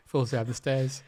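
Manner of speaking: ordinary delivery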